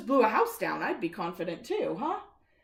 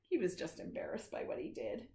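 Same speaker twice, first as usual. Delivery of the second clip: soft whispered aside; 10 dB quieter than usual. A narrator's reading. Telling a child the wolf is embarrassed, whispered. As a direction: whisper it like a secret.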